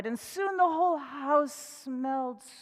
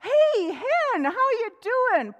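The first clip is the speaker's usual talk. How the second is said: much higher, louder rabbit; 11 semitones higher, 8 dB louder, slower than usual. Acted. Rabbit greeting the hen, high and loud. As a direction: chirp back a greeting. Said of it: "And Rabbit looked up".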